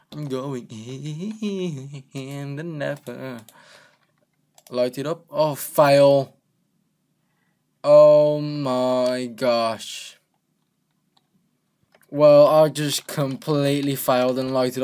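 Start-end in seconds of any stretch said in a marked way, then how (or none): 0.1-3.4 very slow, soft and stretched out; dramatic drawl. Pretend. 7.8-10.2 very slow, loud drawn-out oh my gosh; exasperated groan. Real, not acted. Failed portal lighting.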